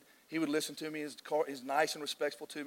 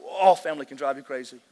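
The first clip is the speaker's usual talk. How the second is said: loud; 11 dB louder than usual. Real, not acted. Preacher's loud punchline.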